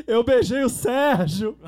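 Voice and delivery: slow, loud laughing cry; mock yell. Drunk friend at the carnival block, laughing mock shout. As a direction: shout it out in disbelief, half laughing.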